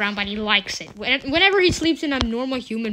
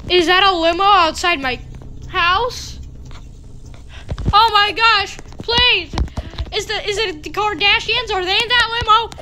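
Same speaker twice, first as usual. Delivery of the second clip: starstruck excitement; 7 semitones higher than usual. Real, not acted. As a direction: gush like a thrilled fan. Celebrity limo outside house.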